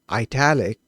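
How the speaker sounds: ITALIC said "eye-TAL-ic"; italic with long I. Shown as a demonstration. Coder explaining where the variant comes from, eye-TAL-ic pronunciation. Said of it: "people who say Iraq instead of Iraq".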